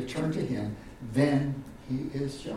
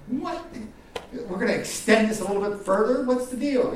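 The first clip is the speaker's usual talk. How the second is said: mock bafflement; 8 semitones higher, 3 dB louder than usual. Mock puzzlement over extra days.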